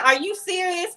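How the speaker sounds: high, incredulous question; mock high disbelief from the cousin; disbelieving cousin. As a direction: ask it high as if it's a joke. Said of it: "she thought it was a joke".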